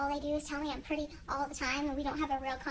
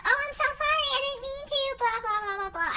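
high, louder whiny mock apology trailing into blah blah; 9 semitones higher, 3 dB louder than usual. Mocking the guys who apologize, in a high whiny voice. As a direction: parody a guy begging forgiveness. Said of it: "and be like".

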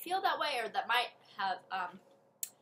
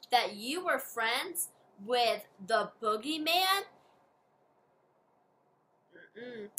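ordinary talk, nothing marked

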